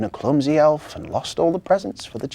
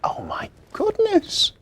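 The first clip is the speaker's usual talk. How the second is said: high, breathless tearful whisper; sniffly whisper; 4 dB louder, slower than usual. Acted. Elf Marzi, still crying in her sleigh, sniffly whisper. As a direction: sniff it out through tears. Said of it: "sniffed Marzi".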